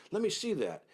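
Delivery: high, eager demand; excited sheik reaches for the jacket. Retold or imitated. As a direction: grab it, voice way up.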